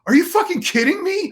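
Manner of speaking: loud, high-pitched disbelief; incredulous outburst. Retold or imitated. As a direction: burst out, appalled at the absurdity. Retells outburst over dog seat.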